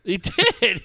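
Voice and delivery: normal talking voice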